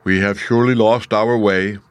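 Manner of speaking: a touch of loud complaint; grumbling. A narrator's reading. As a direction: grumble a bit, pushing the volume. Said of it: "began to grumble a bit".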